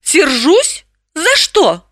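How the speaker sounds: high, questioning woman's voice; light, wondering disbelief; surprised. A narrator's reading. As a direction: ask in surprise, pitch lifted right up. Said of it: "Она удивилась".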